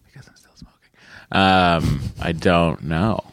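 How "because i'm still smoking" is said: whispered, almost no voice; a guilty aside, whispered. Real, not acted. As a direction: confess it under your breath. Confessing a guilty secret aside.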